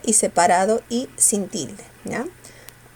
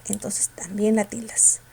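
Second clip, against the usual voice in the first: whisper; 5 dB quieter than usual. Real, not acted. Aside to student.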